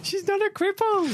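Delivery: high, mock-indignant old lady. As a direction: squeak it like a flustered granny.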